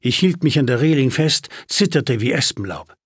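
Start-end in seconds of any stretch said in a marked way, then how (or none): none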